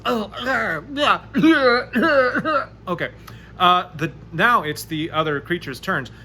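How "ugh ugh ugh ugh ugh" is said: rising, louder grunts of disgust; disgusted ughs. Acted. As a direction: retch out ughs of revulsion. Character sitting in sewer muck.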